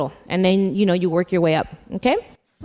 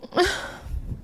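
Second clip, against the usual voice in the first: giddy breathy laugh; 9 semitones higher, 8 dB quieter than usual. Real, not acted. Surprised with flowers on stage.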